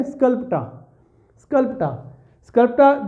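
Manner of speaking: "स्कल्पटा" with no final r, British style; British pronunciation. Shown as a demonstration. Teacher showing students "स्कल्पटा" without the final r. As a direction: say "स्कल्पटा" and drop the last r. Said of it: "आर को रोक ले".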